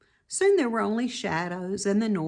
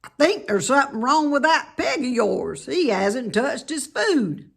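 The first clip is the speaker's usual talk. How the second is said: louder, higher rustic voice; worried farmhand; 6 semitones higher, 7 dB louder than usual. Acted. Lurvy telling Mister Zuckerman about the pig, worried. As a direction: report the sick pig. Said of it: "Lurvy went to Mister Zuckerman".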